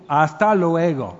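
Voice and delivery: "Hasta luego" slowed down in a heavy American gringo accent. Shown as a demonstration. Preacher mocks his own goodbye to his teacher, slow gringo accent. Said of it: "así, muy gringo".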